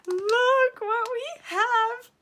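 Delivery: high, loud and slow, near a shriek; excited shout. Real, not acted. Vlogger shouts to viewers as she reveals brushes, thrilled.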